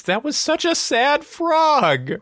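high, slow, pitiful voice; a hammed-up pout over the frog; mock sad. Co-host recalls the sad frog ending, high and pitiful.

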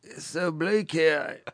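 lightly put-on Australian accent, slow on the bleak line; a half-hearted Aussie cliché voice. Cohost mocking Australian clichés, light Australian accent. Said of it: "with your accent?".

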